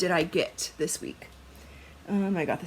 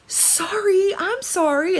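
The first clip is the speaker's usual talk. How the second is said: mock apology; 12 semitones higher, 4 dB louder, slower than usual. Retold apology to friend.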